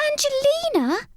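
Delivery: high spluttered protest; a boy mouse spluttering in shock. A narrator's reading. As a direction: splutter the name in disbelief. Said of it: "spluttered Sammy".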